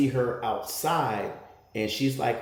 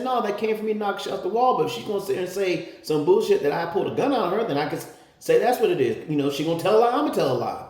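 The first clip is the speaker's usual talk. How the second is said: heated rant; 7 semitones higher, faster than usual. Retold or imitated. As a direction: argue hard with the officer. Ranting at the officer.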